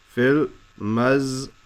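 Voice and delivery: "Fil mazra'ati" broken up with pauses, Fil, maz, ra, a, ti; syllable by syllable. Shown as a demonstration. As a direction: sound out "Fil mazra'ati" piece by piece. Teacher breaks the phrase into syllables.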